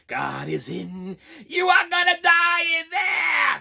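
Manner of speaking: slow drawn-out threatening yell, rising high; menacing preacher shout. Retold or imitated. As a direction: bellow the doom warning like a mad preacher. Imitating Kane's threatening shout.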